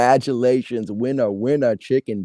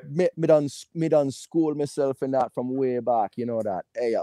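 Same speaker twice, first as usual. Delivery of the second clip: playful Jamaican patois, trailing softer; lilting mock patois; patois accent; 3 semitones higher, 4 dB quieter than usual. Shown as a demonstration. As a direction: boast in a put-on Jamaican accent. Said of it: "talk in a patois accent".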